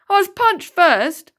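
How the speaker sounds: high, loud, slow wail; boy protesting to the teacher. A narrator's reading. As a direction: whine it for sympathy. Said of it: "Rohan's wailing".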